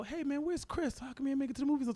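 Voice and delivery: very high-pitched imitation of a friend; puzzled friend. Friend asking why Chris missed the movies, high mock voice.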